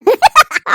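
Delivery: short high-pitched laugh; amused high giggle. Acted. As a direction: burst into a brief, high chuckle. Grandmother amused by grandson-in-law's complaint.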